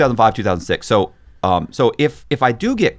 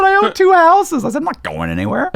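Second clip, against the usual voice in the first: cocky scoff; 4 dB louder than usual. Retold or imitated. Him mocking the detective's warning, cocky and scoffing.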